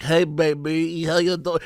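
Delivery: a lightly done slick Hollywood boss; smooth mob voice. Retold or imitated. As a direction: lay on a slick, easy charm. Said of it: "His voice is like".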